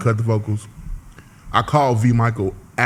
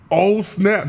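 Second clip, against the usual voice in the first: retold realization; 8 semitones higher than usual. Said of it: "So I'm like".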